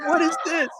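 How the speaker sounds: mock disbelief at a pile of papers; incredulous high-pitched question; baffled surprise. His reaction to the papers.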